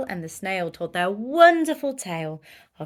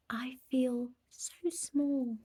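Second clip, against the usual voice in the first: slow, softer whisper, small and amazed; awed whisper; 4 semitones higher, 6 dB quieter, slower than usual. Acted. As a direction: breathe it in wonder. Snail awed by world.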